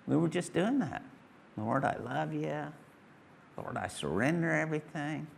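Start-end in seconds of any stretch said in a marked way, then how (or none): 1.6-5.3 prayerful. Shown as a demonstration. Preacher showing the congregation how to worship, soft prayer. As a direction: pray it quietly and sincerely.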